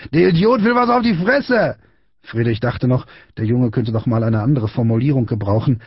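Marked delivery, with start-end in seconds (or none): 0.1-1.8 high-pitched mocking crow; jeering. A narrator's reading. Big boy laughing at Friedrich before his gang, mocking shout. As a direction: announce it scornfully to the gang. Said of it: "fing an zu lachen".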